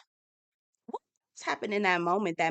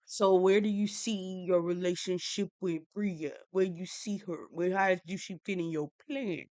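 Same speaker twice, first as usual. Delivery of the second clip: soft, measured mock interrogation; hushed mock-serious questioning; traditional man; 6 dB quieter than usual. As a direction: imitate a stuffy man, softer. Imitates traditional husband questioning.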